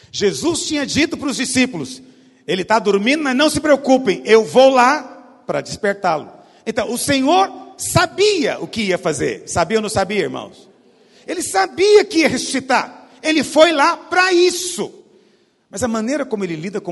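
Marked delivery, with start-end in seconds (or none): 2.5-6.3 louder, preached promise; a hint of Jesus, declared boldly to the disciples; preacher voicing Jesus to the disciples, loud and sure; Jesus' promise proclaimed. Preacher voices Jesus' words. 11.3-14.9 higher, louder preaching; full-force preaching. Acted.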